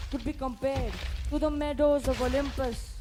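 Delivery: ordinary talk, nothing marked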